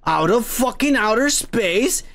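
ordinary delivery

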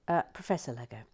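ordinary talk

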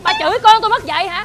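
loud, high-pitched outraged challenge; angry shout. Acted. Mother confronts neighbour who insulted her son, shouting angrily. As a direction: shout it back at the neighbour, furious.

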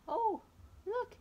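high, loud gasp of delight; sudden thrilled "Oh, look!"; excited exclamation. Acted. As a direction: point it out with a burst of joy. Discovering the brush.

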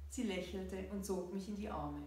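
ordinary delivery

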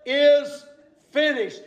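preacher's re-enacted triumphant cry. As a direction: proclaim it with a pause after each word. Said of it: "Jesus cried".

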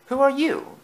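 high-pitched, startled question; surprised cry. A narrator's reading. Annie to a strange engine at the sheds, surprised cry. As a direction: cry it out high, taken aback. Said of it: "cried Annie".

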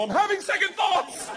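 high, drawn-out wail; acted-out cold feet, shouted; panicked yell. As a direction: plead slowly at a high pitch. Panicking at plane door.

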